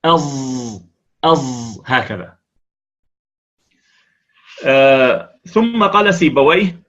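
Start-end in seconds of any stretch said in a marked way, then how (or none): 0.0-1.8 أظ with an emphatic voiced z; voiced sad like z. Shown as a demonstration. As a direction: buzz the emphatic z in أظ. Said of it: "تقول أظ".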